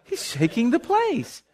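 high, slow mock-serene voice; lightly voiced pious calm, high and slow; a hint of a sugary saint; mock calm believer. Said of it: "any of us would say".

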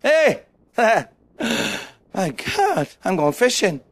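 drawn-out put-on amazement dropping to offhand; comic slow wonder, then a shrug; mock casual reaction. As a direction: parody someone wandering off unbothered. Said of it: "Or would you go fishing?".

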